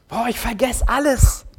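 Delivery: mock exasperation. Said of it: "Wie oft sagen wir Sachen über uns".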